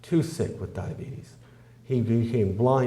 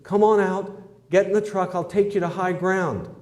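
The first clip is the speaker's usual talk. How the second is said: bullhorn call; 6 semitones higher than usual. Acted. Sheriff calling the man out through a bullhorn, raised voice. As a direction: hail him from the truck over the bullhorn. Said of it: "he got on the bullhorn".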